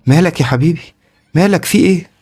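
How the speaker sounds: high, urgent worried questioning; alarmed mother. A narrator's reading. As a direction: act a frightened mother checking on her child. Mother alarmed at screaming child.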